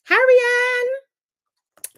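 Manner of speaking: high, long drawn-out hello; loud, high-pitched welcome; calling out greeting. Real, not acted. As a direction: sing out the greeting, stretching the name. Calling out to arriving viewer.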